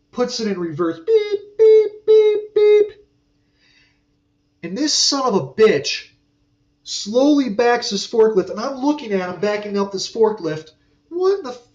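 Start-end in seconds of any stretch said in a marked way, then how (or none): 1.0-3.1 high, loud mimicked forklift backup beeps; imitated reverse beeping. Mimicking the forklift backing toward his toolbox, high loud beeps. 11.1-11.7 high, cut-off what the f-; startled outburst. Retold or imitated. Watching the forklift back up, high startled cut-off curse. Forklift backing at his toolbox.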